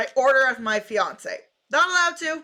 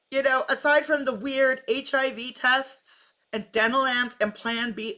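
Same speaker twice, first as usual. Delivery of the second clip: ordinary delivery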